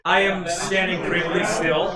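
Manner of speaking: usual speaking voice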